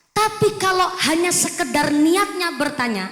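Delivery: usual speaking voice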